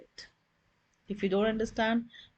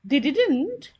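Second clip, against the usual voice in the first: "They didn't" raised high and louder, rising at the end like a surprised question; teacher modelling a US-style intonation question; rising question tune; 5 semitones higher, 5 dB louder than usual. Shown as a demonstration. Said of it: "this is the way a question is asked".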